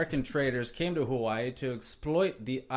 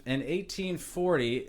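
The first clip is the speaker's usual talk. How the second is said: normal talking voice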